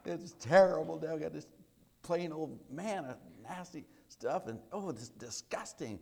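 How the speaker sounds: high-pitched griping, loud burst then dragged out; acted complaining. Israelites griping about plain old manna, exaggerated complaint. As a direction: imitate grumblers disgusted by their food. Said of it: "they're complaining about it".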